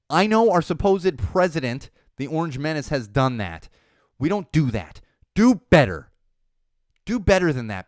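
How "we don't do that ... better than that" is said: angry scolding. Real, not acted. Scolding Lewandowski.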